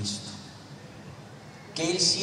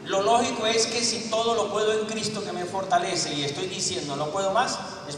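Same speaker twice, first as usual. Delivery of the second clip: full-force preaching; 3 semitones higher than usual. Acted.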